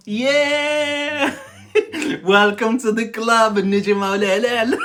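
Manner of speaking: loud, high, laughing welcome. Real, not acted. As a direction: welcome them in while laughing.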